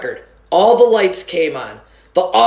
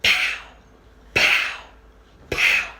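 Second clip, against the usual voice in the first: whisper; 5 dB quieter, slower than usual. A narrator's reading. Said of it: "Then I heard Fudge whisper".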